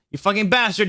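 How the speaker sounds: high-pitched, mild mock yell. A narrator's reading. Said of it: "yelled Draco at Vampire".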